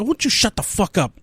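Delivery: a Bostonian telling someone off; quoting the Boston stranger again in his accent. Retold or imitated. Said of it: "Boston accent".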